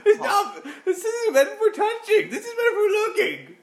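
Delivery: squeaky giggling reprimand; high-pitched laughing voice. Pretend. Scolding strip club customer.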